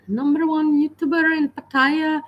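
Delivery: put-on parroting the skit's Indian accent. Viewer copying the skit line, mild Indian accent. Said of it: "I can't do accents".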